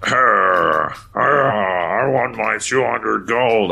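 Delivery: put-on high, slow growly orc with British accent. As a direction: ham up a gruff British orc demanding gold. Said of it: "like a British orc".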